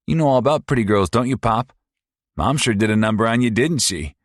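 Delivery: high, loud, fast mocking sneer; sneering abusive son. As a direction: taunt her like a cruel bully. Paul taunts his father.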